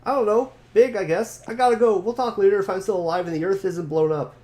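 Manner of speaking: panicking about the incoming meteor; excited character voice. Acted.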